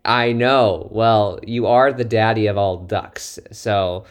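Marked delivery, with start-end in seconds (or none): none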